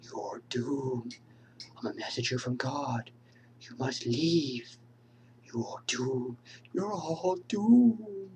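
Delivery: slow doom-laden whisper; ominous prophet, very slow and hushed; slow ominous raspy drawl. Acted. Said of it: "Crazy Ralph, who's another well-known character".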